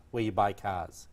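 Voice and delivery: normal talking voice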